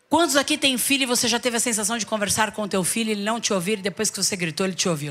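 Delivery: usual speaking voice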